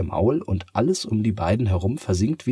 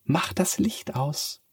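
cat voice; 9 semitones higher, slower than usual. Acted. Cat gives an order. (hohe, langsame Katzenstimme)

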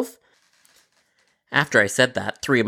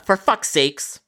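exasperated; 6 semitones higher, slower than usual. Retold or imitated. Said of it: "I had to let out a".

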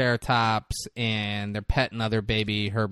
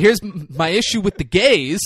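mock rant; 6 semitones higher, 7 dB louder than usual.

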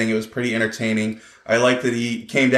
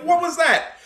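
baffled. Retold or imitated. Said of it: "I'm like".

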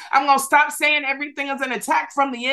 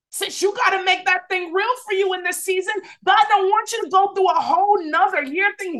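high, driving preacher cadence; rising sermon fervor; full-force preaching; 6 semitones higher than usual. Acted. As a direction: preach it out to the congregation.

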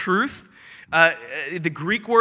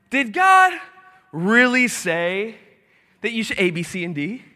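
devil's mocking doubt. Devil lying to you, mock-incredulous questioning. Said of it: "this is how the devil lies".

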